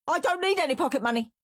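high, rapid shriek. A narrator's reading. Henry defying dad over pocket money, shrieked. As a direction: shriek it fast in defiance. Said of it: "shrieked Henry".

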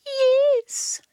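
very high purred yes; purring cat. A narrator's reading. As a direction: purr it like a smug cat. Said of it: "Cat purred".

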